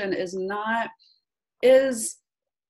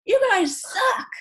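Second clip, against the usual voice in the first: high, loud, slow mock complaint; hammed-up angry customer, high and loud; mock angry rant; 13 semitones higher, 4 dB louder, slower than usual. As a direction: whine it out like a fed-up caller, pitched way up. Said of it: "if I'm just sitting there going".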